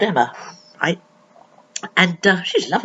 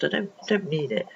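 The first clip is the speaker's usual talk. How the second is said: plain reading voice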